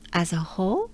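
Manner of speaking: ordinary talk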